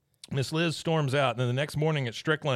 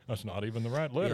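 quick flat repeat of that's not even the right letter; fast plain repeat; 4 dB quieter, faster than usual. Retold or imitated. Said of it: "just clue into what's going on".

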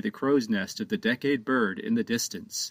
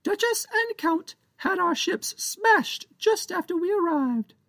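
much higher squeaky pirate voice, indignant; a pint-sized pirate captain venting his grievance; tiny pirate captain blaming Captain Joe, squeaky and cross; angry tiny pirate. Acted.

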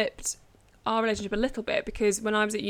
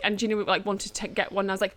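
ordinary talk

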